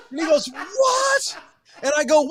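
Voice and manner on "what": very high mock shout; hammed-up incredulous question; baffled dad. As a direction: yelp it like a bewildered dad.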